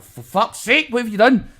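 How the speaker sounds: high, alarmed outburst; horrified. Retold or imitated. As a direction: scold the driver in disbelief. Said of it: "And I was like".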